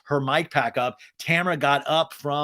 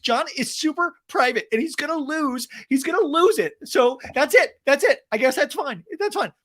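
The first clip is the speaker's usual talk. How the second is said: mock Shannon; 12 semitones higher, 4 dB louder than usual. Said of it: "Shannon's like".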